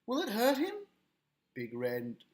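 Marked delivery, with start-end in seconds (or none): 0.1-0.9 high, anxious question; worried. Acted. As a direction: ask fearing for your child. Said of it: "looked very worried".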